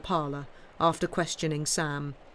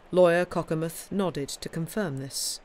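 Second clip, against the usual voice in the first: plain reading voice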